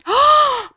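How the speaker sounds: sharp high gasp of alarm; shocked gasp. Acted. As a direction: gasp as if something slipped. Giant realises trousers falling.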